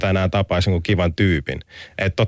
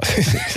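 short embarrassed laugh. Real, not acted. Embarrassed laugh about weekend.

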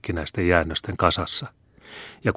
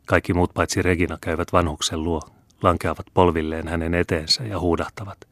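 usual speaking voice

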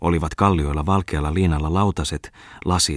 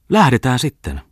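higher, louder teasing offer; playful; 8 semitones higher, 6 dB louder than usual. A narrator's reading. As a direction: toss it off playfully, much higher. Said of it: "sanoi silloin pianisti leikittelevästi".

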